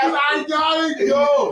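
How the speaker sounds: high, slow, crying; desperate wail. Retold or imitated. Needing the bathroom, wailing desperately. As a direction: wail it like a sob.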